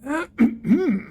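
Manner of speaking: throat-clearing to interrupt; small attention-getting ahem; polite cough. Acted. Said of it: "polite cough".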